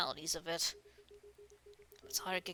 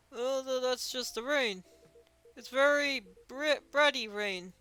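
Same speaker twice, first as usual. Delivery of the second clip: slow, loud, higher character voice; Dooley; 5 semitones higher, 6 dB louder than usual. Acted. Officer excuses crumbs on his face, slow, high made-up voice. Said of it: "another guy make up another voice".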